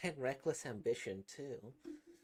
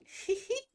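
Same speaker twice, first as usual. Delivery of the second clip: high laugh; 5 dB louder than usual. Acted. Laugh burst.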